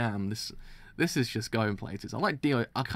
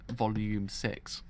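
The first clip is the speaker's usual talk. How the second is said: ordinary talk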